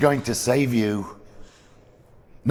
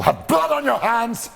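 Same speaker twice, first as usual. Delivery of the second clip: angry; 14 semitones higher than usual. Acted. Father condemning daughter.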